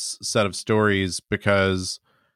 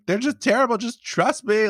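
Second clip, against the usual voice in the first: high, strained mock panic, much higher; mock hysterical screaming; 13 semitones higher than usual. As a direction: squeal it like a ranting zealot. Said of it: "he's just screaming".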